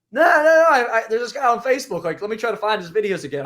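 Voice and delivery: put-on high, loud, flustered old man insisting; old churchgoer waving it off, pitch way up; insistent old man. Old church friend insisting he's found someone else, high and loud.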